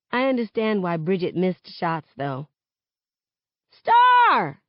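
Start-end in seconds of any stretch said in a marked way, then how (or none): 3.7-4.6 high, loud call for the ball. A narrator's reading. Teammate calling for the pass, loud call. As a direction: call her name to get the pass. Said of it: "Haley calls".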